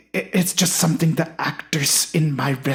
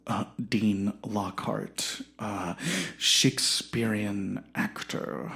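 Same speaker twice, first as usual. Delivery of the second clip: grandiose actor, fading to a whisper; 6 semitones lower, 6 dB quieter than usual. Acted. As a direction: lower and much softer, slow, trailing to breath.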